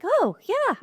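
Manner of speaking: sudden high squeak of recognition; oh yeah, it clicks; remembering something from micro class, with a high squeaky oh; dawning recall. Retold or imitated. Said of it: "And I was like".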